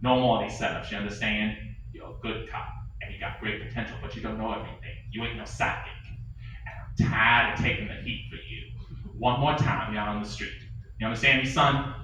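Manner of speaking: low, soft, tired fatherly warning; weary boss. Acted. The captain letting his cop off, a low weary warning. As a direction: talk him down, tired.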